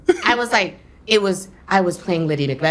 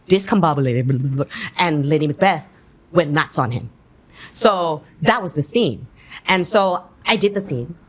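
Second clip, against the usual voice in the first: ordinary talk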